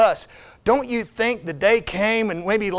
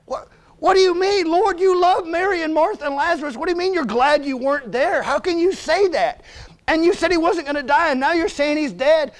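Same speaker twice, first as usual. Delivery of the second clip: higher-pitched, disbelieving questioning; bewildered protest; 6 semitones higher than usual. Acted. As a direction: plead with the Lord in mock disbelief. Acted protest of baffled believers to Jesus.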